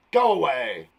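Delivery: loud, forceful exclamation. Shown as a demonstration. Teacher showing an exclamation mark, forceful shout. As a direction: exclaim it like an order. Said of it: "with force".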